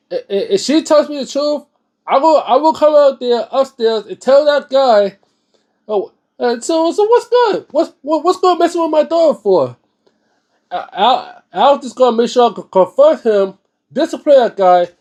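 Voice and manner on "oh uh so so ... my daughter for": high-pitched, indignant challenge; confronting the guy. Pretend. Father confronting the man who hurt his daughter, indignant high challenge. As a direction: square up to him, voice shooting up.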